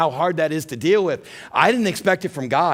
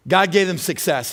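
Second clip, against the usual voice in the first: ordinary talk, nothing marked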